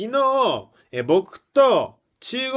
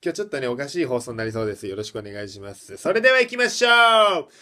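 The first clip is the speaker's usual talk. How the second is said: ordinary delivery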